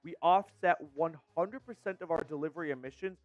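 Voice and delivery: smooth, even ad-copy read; sponsor ad read. Acted. Host reading the Factor Meals sponsor spot, ad read. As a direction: sell the meal service clearly.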